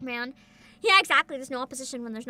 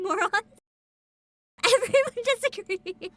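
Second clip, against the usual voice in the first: laughing; 8 semitones higher, 6 dB louder than usual. Real, not acted. Insulting union reps, laughing.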